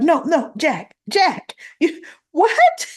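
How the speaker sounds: high, squeaky mock disbelief; shocked Tracy. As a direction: shriek a stunned no, no. Tracy shocked at firing, voiced.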